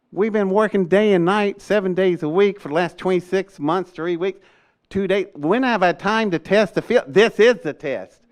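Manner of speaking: retelling a high, indignant outburst, building to a laugh; exasperated protest. Retold or imitated. Telling the colonel he never had time to test, exasperated. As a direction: defend yourself with rising exasperation.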